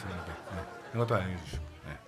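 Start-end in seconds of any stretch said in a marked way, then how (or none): none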